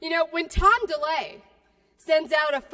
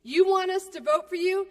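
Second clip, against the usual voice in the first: usual speaking voice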